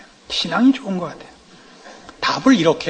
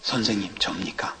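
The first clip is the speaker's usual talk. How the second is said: unvoiced whisper, much softer; whispering Peter; 13 semitones lower, 11 dB quieter than usual. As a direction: ask it under your breath. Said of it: "베드로가 나서서".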